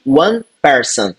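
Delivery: "one person" said slowly and clearly; slow model phrase. Shown as a demonstration. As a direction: dictate it slowly. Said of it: "escrever dessa forma".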